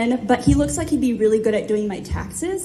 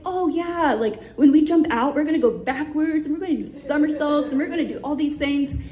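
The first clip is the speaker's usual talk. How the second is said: higher-pitched mock buddy chatter; light, eager imitation, speeding up; yucking it up; 4 semitones higher than usual. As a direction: imitate two pals excitedly making plans, pitched up. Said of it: "yucking it up like they are long lost brothers".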